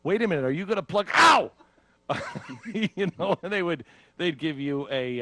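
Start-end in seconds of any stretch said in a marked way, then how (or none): 0.0-1.1 high, loud, rushed objection; re-enacted nervous protest; alarmed protest. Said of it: "I'm like". 1.1-1.5 sudden high, loud "ow"; mock shriek of pain; pained yelp. Acts being shocked mid-sentence.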